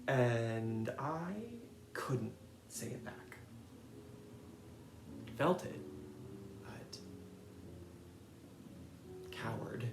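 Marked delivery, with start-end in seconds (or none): 2.7-9.9 hushed self-mocking whisper; a quiet whispered confession. Real, not acted. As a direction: whisper it, ashamed.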